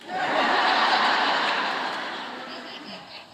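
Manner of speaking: high, breathless burst of laughter; real laugh. Real, not acted. Laughs at memory of flip phone.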